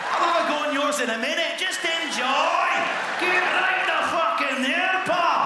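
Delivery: high, loud Scottish dog; Scottish terrier voice. Pretend. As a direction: bellow it, generous and rowdy. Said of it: "Scottish terrier".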